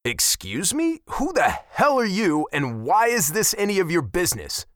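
sharp indignant retort; snapping back. A narrator's reading. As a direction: snap back at her, offended. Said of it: "I snapped back".

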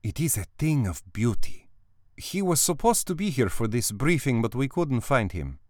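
lightly voiced armorer, savoring her words; relishing armorer. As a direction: describe the rifle as if it were a lover. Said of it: "she practically salivated as she described".

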